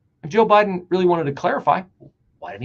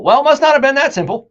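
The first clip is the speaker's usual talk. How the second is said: sarcastic; 9 semitones higher, 10 dB louder, faster than usual. Real, not acted. Sarcastic rebuttal.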